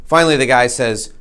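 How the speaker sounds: usual speaking voice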